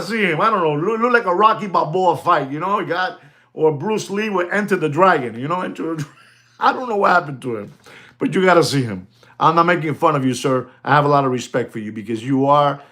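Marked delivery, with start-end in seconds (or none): none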